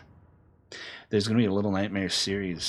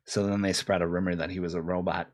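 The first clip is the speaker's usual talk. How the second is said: ordinary talk, nothing marked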